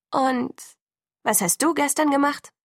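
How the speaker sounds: high-pitched, miffed question; annoyed. A narrator's reading. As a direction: ask pointedly, put out at being ignored. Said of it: "Sie war offensichtlich verärgert". (hörbar verärgert)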